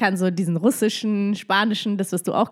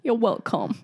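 put-on British accent. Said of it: "richtig guten britischen Akzent".

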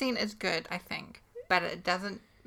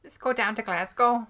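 louder put-on Scottish accent; 7 dB louder than usual. Shown as a demonstration. Demonstrating the city-name trick, Scottish accent. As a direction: play a Scot heading out. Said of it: "to do a, a Scottish accent".

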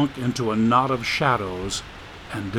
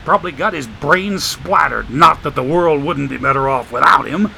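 streetwise homeless man; 2 semitones higher than usual. Acted. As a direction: play the wisecracking drifter, amused. Joshua praying and grumbling.

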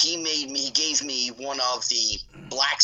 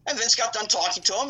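ordinary talk

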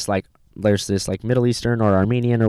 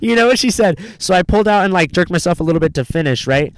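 ordinary talk, nothing marked

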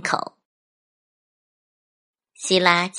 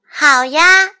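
put-on high, loud little girl voice; agreeable child; 8 semitones higher, 9 dB louder than usual. A narrator's reading. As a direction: chirp agreement like a kid. (高亢爽快的小女孩声音)

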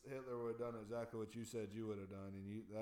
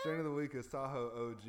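announcer voice; 5 semitones higher, 9 dB louder than usual. Pretend. Announcing segment on podcast.

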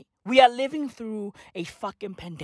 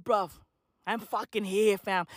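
ordinary delivery

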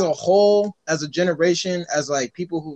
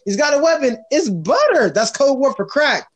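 alarmed cop voice; 8 semitones higher, 4 dB louder than usual. Retold or imitated. Mock police paranoia.

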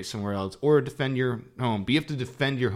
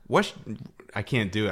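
usual speaking voice

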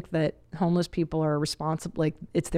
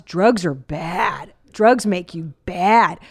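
put-on slow warning to toddler; 3 dB louder, much slower than usual. Shown as a demonstration. Lecturing toddler about drugs.

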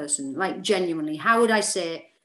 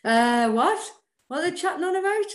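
baffled; 9 semitones higher than usual. Retold or imitated. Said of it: "I'm like".

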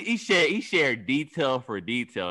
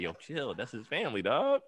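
hushed, urgent yo, chill; hushed warning; 8 dB quieter, slower than usual. Retold or imitated. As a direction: warn him under your breath. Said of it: "And Gerald's like".

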